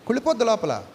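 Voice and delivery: ordinary talk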